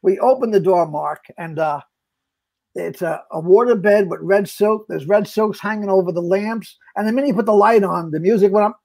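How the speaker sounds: usual speaking voice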